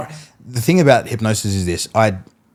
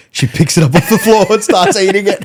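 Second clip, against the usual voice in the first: laughing; 8 semitones higher, 4 dB louder than usual. Real, not acted. Laughing retelling strawberry spit.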